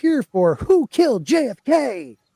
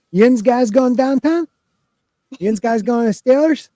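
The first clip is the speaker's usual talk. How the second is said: put-on higher, louder Pittsburgh Yinzer accent; 6 semitones higher, 3 dB louder than usual. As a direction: play up the Pittsburgh Yinzer twang. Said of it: "I'll start doing Pittsburgh".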